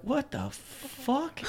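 high, soft, very drawn-out question; mock stunned disbelief. Storyteller replaying news that dude hit his head, mock shock. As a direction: stretch it slowly in a hushed high pitch.

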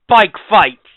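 high, loud mock announcer call; segment announcer shout. Co-host announces a joke new segment, big announcer shout. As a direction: holler it like a new show segment title.

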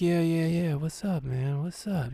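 mumbled softly, barely awake; a drowsy friend roused in the front seat; half asleep. Retold or imitated. Half-asleep friend answering the narrator who woke him: soft, drowsy mumble. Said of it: "You know, half asleep".

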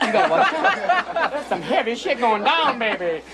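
high slow jive warning; high hepcat voice; hip jive voice. Acted. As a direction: warn him cool and laughing.

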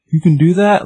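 high, eager and hopeful; a dog daring to hope his friend returns. Retold or imitated. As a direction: ask it with sudden bright hope. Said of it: "Like, all, like, hopeful".

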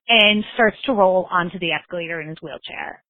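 ordinary talk, nothing marked

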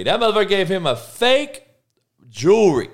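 high, loud indignation; outraged. Real, not acted. Outrage repeated.

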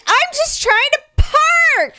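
high, slow, loud whiny plea; exasperated whine. Retold or imitated. Mother whining at the bus driver behind her.

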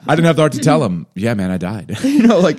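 talking through high laughter; laughing. Real, not acted. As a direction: laugh the words out fast. Laughing while telling.

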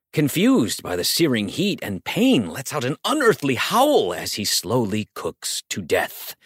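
ordinary delivery, nothing marked

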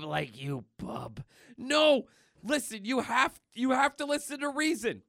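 Weapon X boss pleading. Retold or imitated. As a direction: beg in a shrill cartoon villain pitch. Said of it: "Weapon X".

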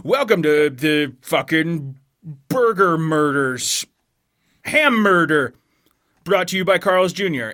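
slow, dramatic podcast host voice; lightly voiced spoof intro; mock podcast intro. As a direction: announce it like a true crime host. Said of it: "I'll just be like".

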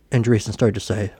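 ordinary delivery, nothing marked

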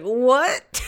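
high, loud "what?" as the husband; retold startled question; mock bafflement.

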